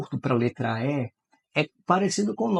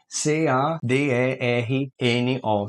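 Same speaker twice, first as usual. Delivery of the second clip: letters of "C A D E R N O" one by one, slow; spelled out; much slower than usual. Shown as a demonstration. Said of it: "quantas letras na palavra caderno". (soletrando devagar)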